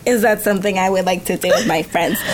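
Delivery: lightly put-on French accent. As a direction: say it like a French guy. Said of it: "I love when you do the French accent".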